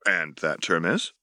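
ordinary delivery

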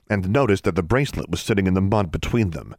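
ordinary delivery, nothing marked

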